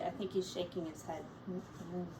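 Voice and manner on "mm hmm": usual speaking voice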